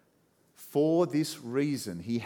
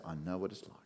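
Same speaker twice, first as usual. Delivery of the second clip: gentle; 5 semitones lower, 8 dB quieter, faster than usual. Pretend. Jesus's gentle reply.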